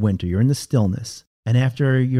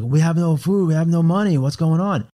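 mock panic; 5 semitones higher than usual. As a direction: imitate your own anxious mind. Mind panicking over bank account.

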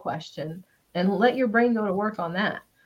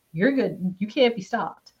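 ordinary talk

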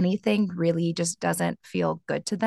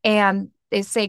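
normal talking voice

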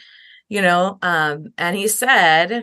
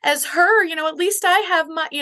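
high, brushing-off imitation; mock comparing pain; 11 semitones higher than usual. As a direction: play down the pain, pitched well up. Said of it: "we wanna wish it away and say".

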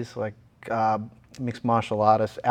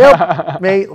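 perky, louder answer; cheery; 7 semitones higher, 9 dB louder than usual. Retold or imitated. Patient answering the surgeon, cheerily and louder. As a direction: chirp it out like nothing's wrong. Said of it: "Still pretty cheery".

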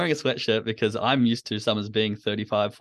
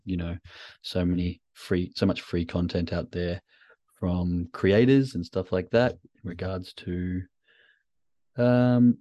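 flat, monotone, lower and subdued; deliberately low energy; 5 semitones lower than usual. Shown as a demonstration. Host opens his show to demonstrate, deliberately flat low energy. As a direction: drag through it with no energy at all. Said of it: "I come in very monotoned. I come in very flat".

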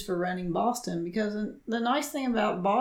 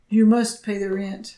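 melodrama villain; 2 semitones higher, 7 dB louder than usual. Pretend. Acting out old melodrama skit.

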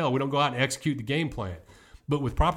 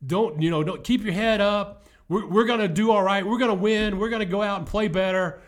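louder, higher cheerful halftime pep talk; upbeat coach pep talk; 7 semitones higher, 5 dB louder than usual. Retold or imitated. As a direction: pump up the losing team, bright and loud. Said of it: "If I go in and be positive".

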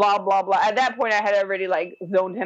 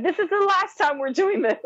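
much higher pitch, exasperated but not shouted; retold anger; 11 semitones higher than usual. Mother gives husband an ultimatum after the test, retold anger. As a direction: replay an angry ultimatum in a raised pitch. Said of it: "and I'm mad".

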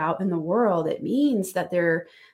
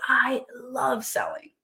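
gushing enthusiast; 4 semitones higher, slower than usual. Acted. Person who adores sales talking, drawn-out and gushing. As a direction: stretch it out, savouring LOVE. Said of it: "are you a person who says".

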